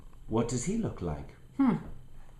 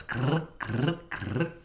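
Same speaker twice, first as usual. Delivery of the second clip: scratchy krr of claws, a little higher; clawing growl; 4 semitones higher, slower than usual. Acted. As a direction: play the monster scratching its claws. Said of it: "showed their terrible claws".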